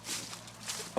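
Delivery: sudden gulping hiccup; a real hiccup catching the breath; sharp hiccup. Real, not acted. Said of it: "Wow, that one".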